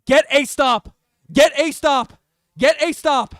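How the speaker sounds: loud, punched chant to the team; urgent shouted plea. Real, not acted. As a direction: shout each word at the defence. Urging team to defend.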